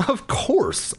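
usual speaking voice

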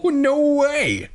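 mock scream leaping very high; hammed-up high denial. A narrator's reading. Gina denying the prank to mom, screaming. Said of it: "Gina screamed".

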